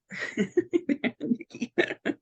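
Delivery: light, high laugh. Real, not acted. Speaker to the group before starting meditation, light laugh. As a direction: laugh for a moment mid-sentence.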